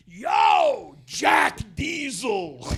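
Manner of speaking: drawn-out high shout of the nickname, slow and loud; calling out loud. Retold or imitated. Nick yelling from the truck window at shirtless Jed, slow loud call. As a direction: holler it slowly across the yard like a buddy teasing.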